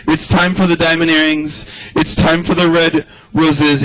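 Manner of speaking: ordinary talk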